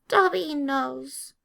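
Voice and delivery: high, slow elf voice; small and earnest, drawn out; timid elf agreeing; house-elf voice. A narrator's reading.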